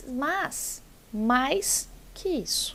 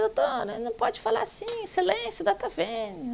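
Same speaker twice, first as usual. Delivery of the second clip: retold protest; 11 semitones higher than usual. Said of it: "Eu falei".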